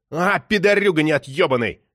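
explosive swearing; enraged cursing. A narrator's reading. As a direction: curse out loud after slamming the phone. Slams the phone down, swears.

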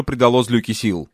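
ordinary delivery, nothing marked

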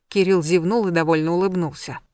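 ordinary delivery, nothing marked

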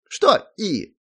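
high, loud gnome question; querying gnome. A narrator's reading. Gnome questioning the hero, challenging. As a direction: throw the word back in a high voice.

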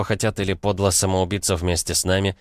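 ordinary delivery, nothing marked

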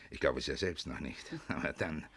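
savouring having him under his thumb; quiet gloating chuckle. Acted. Kutzner to his secretary, quietly chuckling in triumph.

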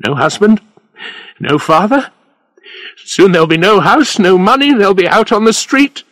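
high, loud desperate outburst, building steadily. A narrator's reading. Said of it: "His voice was getting louder and louder".

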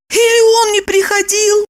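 high, bewildered woman's voice; confused mumbling. A narrator's reading. Woman wonders whether Vanya came home, high confused voice. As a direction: ask it high, at a loss. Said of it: "растерянно промямлила она".

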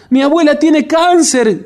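high, loud, panicked cry; desperate scream. A narrator's reading. Margarita realizing her grandmother is sick, desperate high scream. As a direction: shriek it out in despair. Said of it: "gritó Margarita desesperada".

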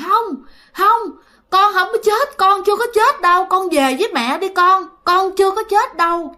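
high-pitched retold wail of denial; tearful pleading. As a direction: imitate a mother pleading through tears, pitched high. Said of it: "Mà vừa khóc vừa nói".

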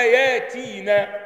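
"ياتينا" slowly with a drawn-out aa; hamza turned to alif. Shown as a demonstration. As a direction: lengthen the first vowel of "ياتينا", no glottal stop. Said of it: "الهمزه هتقلب الى ايه؟".

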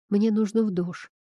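usual speaking voice

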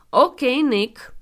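loud, firm manly voice; strong virile voice. Acted. Rincarb addresses the sobbing Santa firmly. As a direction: speak with strong manly authority. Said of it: "cu un glas puternic și viril".

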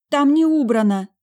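high, loud protest; mumbled excuse. A narrator's reading. Talia guarding the door with an excuse, protesting. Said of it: "промямлила она".

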